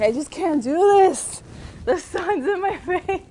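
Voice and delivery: high, hammed-up wail of complaint with a laugh; mock whining. Reporter reenacts a blooper with sun in the face, mock whining. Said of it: "I'm like this the whole time".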